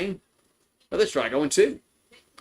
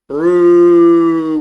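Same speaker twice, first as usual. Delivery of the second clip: huge drawn-out "Brewers" roar, much louder; elongated team call; 3 semitones higher, 14 dB louder than usual. Shown as a demonstration. Trying to please chat, a big drawn-out Brewers call. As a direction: belt "Brewers" long like an arena announcer. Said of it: "Did I do it right that time?".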